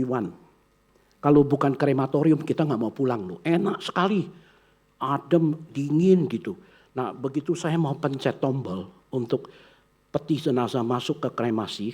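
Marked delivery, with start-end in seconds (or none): none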